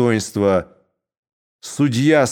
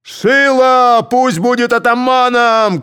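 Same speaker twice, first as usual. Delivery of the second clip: loud, slow, high yell; shout; 11 semitones higher, 5 dB louder, slower than usual. A narrator's reading. Some Cossacks shouting for Shilo, loud. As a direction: push your man's name hard. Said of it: "кричали одни".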